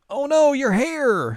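high, loud, slow gasp of alarm; shocked girl. Retold or imitated. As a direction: cry out in shock. Kagome shocked at hair.

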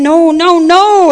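loud, firm no; stern denial. Retold or imitated. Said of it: "he gets a little more stern".

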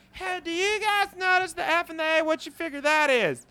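high, loud put-on character voice; suspicious letter snooper. Someone intercepting letters asks a workmate about clues, high goofy voice. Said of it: "someone's gonna go".

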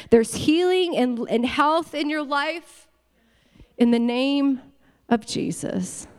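forceful, rolling preacher's cadence; emphatic prayer proclamation; declaring a blessing. Real, not acted. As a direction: build it phrase by phrase over the congregation. Declares blessing over congregation.

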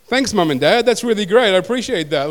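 sarcastic thanks. Retold or imitated. Teasing his parents over his name, in loud mock gratitude. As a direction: thank them sarcastically, voice pushed up.

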